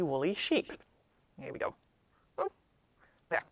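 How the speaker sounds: ordinary delivery, nothing marked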